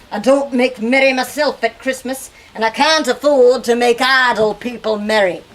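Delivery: loud, firm miserly refusal; Scrooge refusing. Acted. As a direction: bark it back like a stingy old man.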